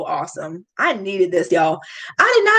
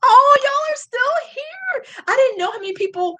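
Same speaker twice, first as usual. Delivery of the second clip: tearful cry-out. Real, not acted. Streamer overwhelmed that viewers stayed, crying out. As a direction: let the voice break with emotion.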